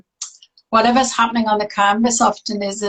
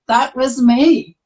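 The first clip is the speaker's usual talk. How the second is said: usual speaking voice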